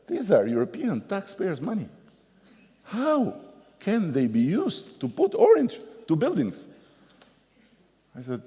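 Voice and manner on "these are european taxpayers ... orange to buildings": pitched way up, outraged and pleading; hysterical French supervisor. Retold or imitated. Supervisor protesting the orange building to the mayor, hysterical. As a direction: squeal the question in disbelief.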